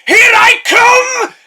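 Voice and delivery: very high, loud dramatic yell; desperate shout. Pretend. Narrator pretending to leap off a cliff, yelling. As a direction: scream it at the cliff edge. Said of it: "walk right up to the edge of a cliff and go".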